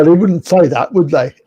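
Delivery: ordinary talk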